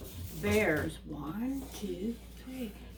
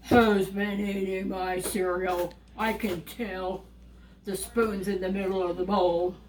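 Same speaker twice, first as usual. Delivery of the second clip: Papa Bear; 3 semitones lower than usual. Acted. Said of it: "Papa Bear goes and looks at his cereal and he goes".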